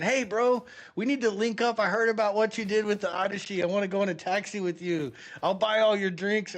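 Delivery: high, keen pitch to a pal; eager buddy. Retold or imitated. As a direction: imitate an excited guy asking to tag along. Acted eager fans approaching him.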